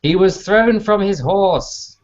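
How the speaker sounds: high, slow, drawn-out exasperation; exasperated protest. Acted. Portia pleads for the thrown rider, slow exasperated protest.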